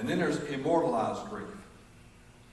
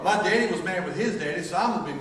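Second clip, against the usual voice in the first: higher, louder hammed-up grumble; mock feud voice; 5 semitones higher, 5 dB louder than usual. A feuding son justifying his grudge, loud mock grumble. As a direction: play the inherited grudge.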